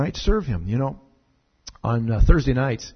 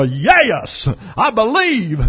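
southern drawl; 6 semitones higher, 5 dB louder, much slower than usual. Retold or imitated. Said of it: "People have said that to me".

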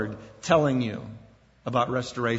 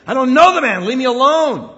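furious, much louder and higher; angry denial; 9 semitones higher, 10 dB louder than usual. Retold or imitated. Peter cursing at his accusers, an angry loud denial. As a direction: shout it out in anger. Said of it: "now he's angry".

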